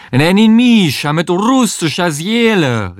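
put-on high, theatrical Russian-accented call; heavy Russian accent. A narrator's reading. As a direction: call out as Russian as you can. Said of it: "so russisch sie konnte". (mit übertrieben russischem Akzent gerufen)

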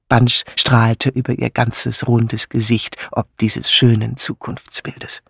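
ordinary delivery

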